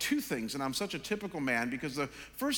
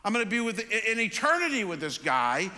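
higher, louder exasperated rant; frustrated rant; 5 semitones higher, 5 dB louder than usual. Retold or imitated. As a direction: vent it all, fed up with the situation. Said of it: "'Cause I sat there and said".

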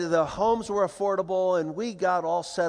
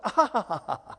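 re-enacted laugh; 3 semitones higher than usual. Dad laughing at what he takes for his friend's joke, mock laughter. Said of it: "I remember laughing".

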